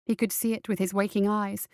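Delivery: ordinary delivery, nothing marked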